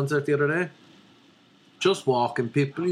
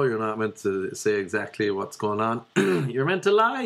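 ordinary talk, nothing marked

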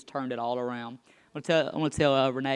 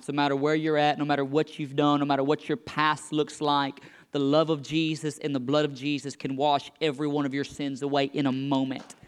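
preaching, building; 5 dB louder than usual. Acted. Pastor to the congregation, building preaching. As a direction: build the altar call up until it rings.

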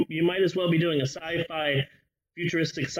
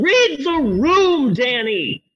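slow, loud, scolding plea; exasperated scolding; 9 semitones higher, 6 dB louder, slower than usual. Acted. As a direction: drag out the scold. Scolding character.

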